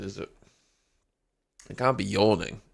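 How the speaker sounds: usual speaking voice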